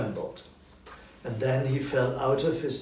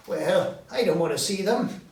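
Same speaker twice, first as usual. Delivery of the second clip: higher, louder protest; reluctant Scrooge; 7 semitones higher, 8 dB louder than usual. Acted. Scrooge refusing the three ghosts, higher and loud. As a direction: refuse like a scared old man.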